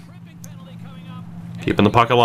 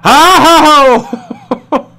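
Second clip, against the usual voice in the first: laughing yelp; 12 semitones higher, 4 dB louder than usual. Real, not acted. Goal scare in hockey game.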